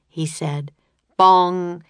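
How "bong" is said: mock bell bong. A narrator's reading. Clock striking.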